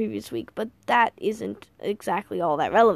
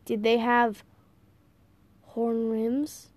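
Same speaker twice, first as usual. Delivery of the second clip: halting, with a long suspenseful pause; slow, deliberate question; much slower than usual. A narrator's reading. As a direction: draw the question out warily. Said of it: "Grandpa Smedley said slowly".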